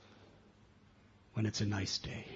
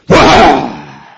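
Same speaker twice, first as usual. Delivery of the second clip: much louder explosive bah; angry bark; 24 dB louder than usual. Acted. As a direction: snap it out like an angry dog. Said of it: "he goes".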